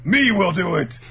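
high, very loud hammed-up yell; clashing outburst. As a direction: blurt it as two fighting selves. Both personalities speaking at once.

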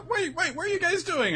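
high-pitched mock alarm; hammed-up high, flustered objection; flustered protest. Viola tries to stop them.

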